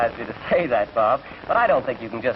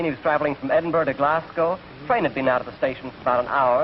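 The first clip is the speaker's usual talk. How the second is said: normal talking voice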